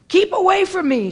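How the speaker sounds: high, loud frightened shout. Acted. Toby shouting at the infected stranger, high and loud. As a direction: yell to keep her off. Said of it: "she shouts".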